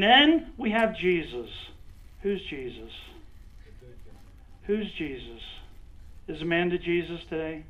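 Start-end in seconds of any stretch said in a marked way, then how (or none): none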